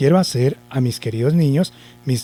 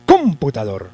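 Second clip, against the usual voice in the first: misplace the accent: CÓM-putador; wrong stress on COM. Shown as a demonstration. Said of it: "lo lleva en la sílaba com". (computador con el acento fuerte en com)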